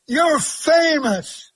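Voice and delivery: put-on slow, loud, drawn-out child's cry of delight; playful imitation of an amazed child; excited little girl. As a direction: call it out like a starstruck six-year-old. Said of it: "And she's going".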